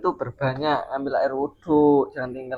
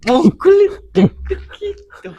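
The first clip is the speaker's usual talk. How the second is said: high squeaky voice; 10 dB louder, much slower than usual. Acted. Comic fight taunt, voice shifted.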